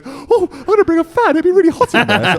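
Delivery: high, squeaky mock of a tall friend; mocking friend's voice. Mocking his tall friend, high and squeaky. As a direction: put on a silly high voice. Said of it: "your six-foot-five friend goes".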